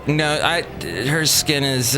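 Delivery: ordinary talk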